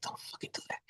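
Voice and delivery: very soft whispered aside; under-the-breath objection. Retold or imitated. Silently objecting to butts thrown out the window, whispered. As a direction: whisper it as a private complaint. Retold private objection to friend's littering.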